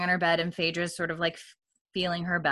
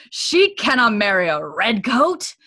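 loud, slow, indignant protest; cranky outrage; 3 semitones higher, 8 dB louder than usual. Retold or imitated. Murtaugh objecting to Jocasta about Brianna's marriage, cranky. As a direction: refuse it flat out, outraged. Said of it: "he's real cranky right here".

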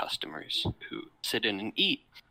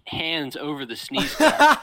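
laughing; 5 semitones higher, 10 dB louder, slower than usual. Real, not acted. Laughing while telling.

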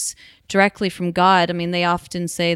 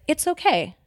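relieved self-talk; 5 semitones higher, faster than usual. Retold or imitated. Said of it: "And I was like".

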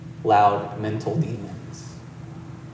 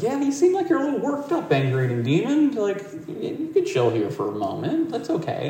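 high, fast, gentle reassurance; calm the worked-up guest down; king reassuring the demon it can chill; soothing king; 11 semitones higher than usual. Retold or imitated.

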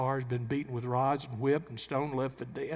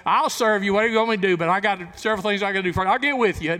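loud, higher, careless brush-off; breezy excuse; 5 semitones higher, 10 dB louder, faster than usual. Retold or imitated. Churchgoer waltzing in with excuses, mock breezy and loud. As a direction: toss off a careless promise. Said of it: "you just waltz in and say".

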